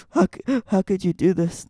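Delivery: high, mock-tearful wail of betrayal; hammed-up hurt boyfriend crying out; imitating tearful accusation. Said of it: "that's exactly what he sounded like".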